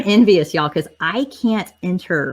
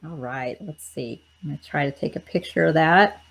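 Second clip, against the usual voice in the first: normal talking voice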